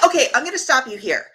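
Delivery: ordinary talk, nothing marked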